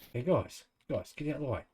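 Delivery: ordinary talk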